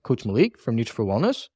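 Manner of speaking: "Coach Malik from Nutrafit Wellness" as a question; questioning intonation. Shown as a demonstration. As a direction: end "Wellness" on a questioning rise. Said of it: "it's more of a question".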